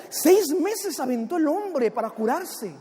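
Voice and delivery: ordinary delivery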